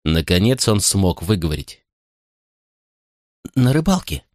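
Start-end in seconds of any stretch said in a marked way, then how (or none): 3.5-4.2 put-on scared child. Terrified boy answers.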